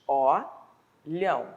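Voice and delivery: Olhão bem devagar, o-lhão; separado em sílabas. Shown as a demonstration. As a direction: abra a boca duas vezes em Olhão. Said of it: "eu precisei abrir a boca duas vezes". (Olhão dito devagar, sílaba a sílaba)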